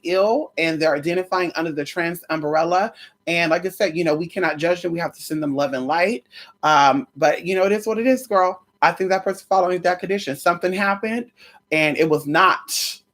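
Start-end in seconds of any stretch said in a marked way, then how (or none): none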